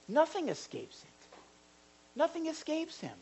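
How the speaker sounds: high, soft note of wonder; hushed, lifted reassurance; reassuring. Shown as a demonstration. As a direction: marvel softly that he sees everything. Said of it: "that's not like a ju".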